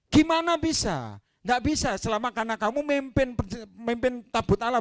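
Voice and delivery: high, loud preacher's cry; rising pulpit passion; fiery preaching. Acted. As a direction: drive the point home to the church. Preaching at full force.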